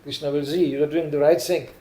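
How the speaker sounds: ordinary talk, nothing marked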